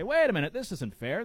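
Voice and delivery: sudden loud "Wait a minute," then settling back; indignant protest. Pretend. Host voicing a rival school's complaint, indignant outburst. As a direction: burst out like a wronged rival school. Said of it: "could say".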